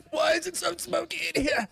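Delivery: high voice with nervous laughter; nervous, intimidated Lemonista. Acted. Lemonista meets the very big dragonborn, high nervous laughing voice. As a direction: babble high, laughing through nerves.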